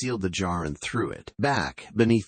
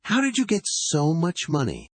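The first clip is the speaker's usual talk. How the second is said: astonished; 9 semitones higher than usual. A narrator's reading. Father astonished at money.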